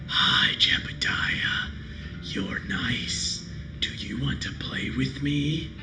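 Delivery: creepy whispered doll voice; eerie breathless doll whisper; talking doll. Acted. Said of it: "its mouth begins to move".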